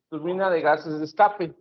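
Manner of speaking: ordinary talk, nothing marked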